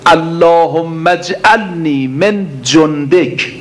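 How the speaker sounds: slow, drawn-out Arabic supplication, each word weighed; slow prayer recitation. Acted. Preacher reciting the supplication to the congregation, slow and drawn out. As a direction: intone the prayer slowly like a preacher.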